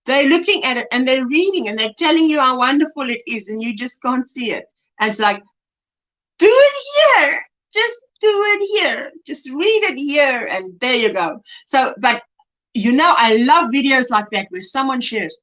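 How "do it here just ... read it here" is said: exasperated viewer's plea. Acted. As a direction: urge them in a raised, slowed voice. Voices viewer's plea to someone off-camera.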